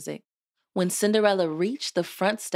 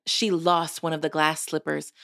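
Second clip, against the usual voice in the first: ordinary delivery, nothing marked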